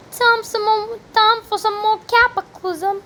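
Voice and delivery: high-pitched, badly faked British; hammed-up mock British accent; terrible British accent. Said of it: "That was a terrible British accent".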